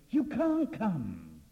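loud, high dramatic cry; threatening wind. Acted. Preacher voices the wind threatening Peter, loud and menacing.

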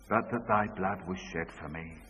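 father's quiet echo. Retold or imitated. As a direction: repeat it back subdued. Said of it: "say this after me".

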